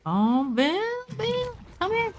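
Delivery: high teasing call to Bill, sing-song; lilting high call. Pretend. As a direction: coax him out like calling a pet. Calling to survivor teasingly.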